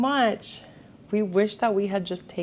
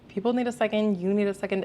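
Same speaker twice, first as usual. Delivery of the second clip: ordinary talk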